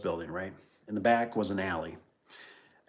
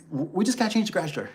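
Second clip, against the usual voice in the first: high, quick mock-nervous plea; nervous stammer; 10 semitones higher, 4 dB louder, faster than usual. Worker explaining himself to an armed man, nervously quick.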